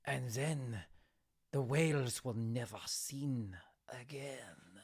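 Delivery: put-on hushed, dramatic close in accented voice; storyteller's ending. Fishmonger ending his tale for tourists, hushed storyteller. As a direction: drop to a near-whisper for the ending. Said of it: "he sort of rounds out his stories".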